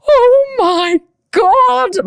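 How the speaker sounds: very slow, high drawn-out cry; slow high relieved groan; exhausted relief. Retold or imitated. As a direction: stretch it out in relief. After finishing set.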